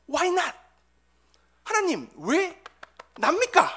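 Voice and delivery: loud, high plea to God; resentful questioning. Acted. Bereaved father questioning God, resentful high outcry. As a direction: cry out the question in protest, word by word. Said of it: "하나님을 원망하며".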